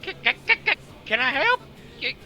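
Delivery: high, squawky, stuttering bird voice; bird creature. Acted. Bird creature offers help to an approaching stranger, squawky bird voice. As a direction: lean hard into a squawking bird. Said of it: "Lean a little heavy into the bird".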